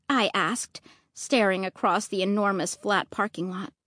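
plain reading voice

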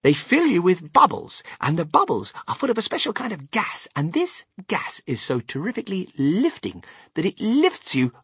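high, animated showman explaining; Willy Wonka, enthusiastic. Acted. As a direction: marvel aloud at the invention.

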